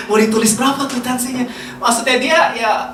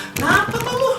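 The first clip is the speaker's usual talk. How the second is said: high, softer teasing question; Jakarta slang schoolmate; 12 semitones higher, 4 dB quieter than usual. Acted. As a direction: play a puzzled schoolmate in a raised pitch. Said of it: "saya pakai dialog Jakarta".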